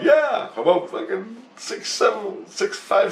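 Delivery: high, slow, excited counting. Retold or imitated. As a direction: strain upward like a giddy kid recalling. Proudly retells his own answer.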